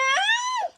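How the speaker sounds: high sharp scream of panic; short frantic shriek; panicked scream. Real, not acted. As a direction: shriek as time runs out. Panic at timer.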